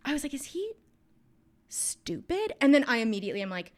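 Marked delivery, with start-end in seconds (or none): 0.3-2.5 high-pitched baffled question; high, halting mock bewilderment; retold incredulity. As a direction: act out puzzled disbelief, pitched way up. Child's baffled reaction, retold.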